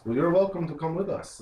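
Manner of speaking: a hint of hearty welcome; Stanimir. Caravan master inviting the travellers, lightly voiced and friendly.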